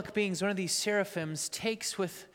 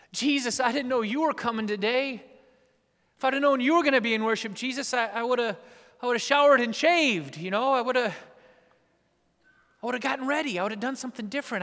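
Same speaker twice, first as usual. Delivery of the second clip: louder, higher rushed pleading; flustered sinner; 5 semitones higher, 7 dB louder than usual. Acted. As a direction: gush out apologies. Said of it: "find a response that said".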